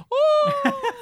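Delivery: high yelping "Oh!" through laughter; hammed-up ghost wail; mock soul cry. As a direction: squeal it like a flying ghost. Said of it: "just goes".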